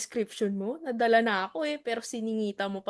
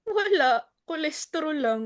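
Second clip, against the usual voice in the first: loud retort; 3 semitones higher, 6 dB louder than usual. Acted. Flat-out retort about chicharon.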